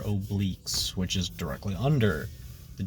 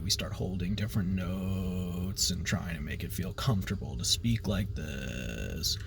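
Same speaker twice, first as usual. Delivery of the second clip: gravelly vocal fry demo for students; 5 semitones lower, 8 dB quieter than usual. Shown as a demonstration. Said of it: "we start with a vocal fry".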